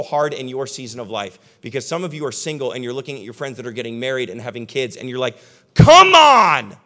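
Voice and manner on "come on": high, loud mock whine of impatience; mock frustrated cry. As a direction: wail it like a frustrated single. Single friend envying married friends.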